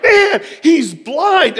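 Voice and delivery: loud, high, drawn-out yell with laughter; excited yelling. Retold or imitated. Pastor astonished that guest is blind, excited yelling. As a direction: re-enact astonished yelling, stretch it out slowly. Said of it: "I was yelling".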